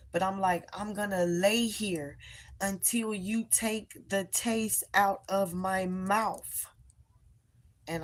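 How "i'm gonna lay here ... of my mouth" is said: higher, insistent mock yell slowing to drawn-out words; restrained retold yell. Demanding God take the taste away, restrained retold yell. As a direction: re-enact a yelled demand without really yelling, stretching the final words. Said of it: "I'm just describing because I was yelling".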